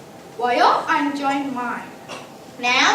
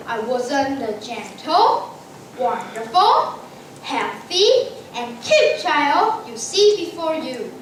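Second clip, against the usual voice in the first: normal talking voice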